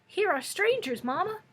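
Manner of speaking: put-on high, loud childish cry; shrill child. A narrator's reading. Unseen child calling to Mamma about strangers, shrill childish cry. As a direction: call out shrilly like a small child. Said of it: "cried the shrill and childish voice".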